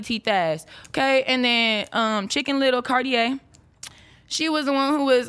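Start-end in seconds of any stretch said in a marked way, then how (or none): none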